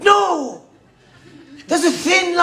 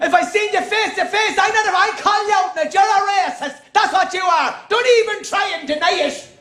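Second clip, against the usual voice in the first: mock Traveller accent; 6 semitones higher than usual. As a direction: ham up a Traveller's accusation, pitched up. Travellers calling him out, mimicked.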